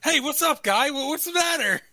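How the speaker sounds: high, loud put-on innocent voice; mocking fake concern. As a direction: play dumb like a smug jerk. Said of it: "[name] being the biggest asshole".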